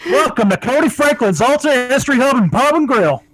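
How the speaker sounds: high mock cowboy greeting; mock Texan host. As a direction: play a hokey Texan bar host.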